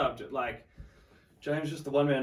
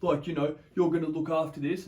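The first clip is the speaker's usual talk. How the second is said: mocking security guy; 6 semitones higher, 6 dB louder than usual. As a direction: draw it out like a scornful bouncer. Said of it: "He's like".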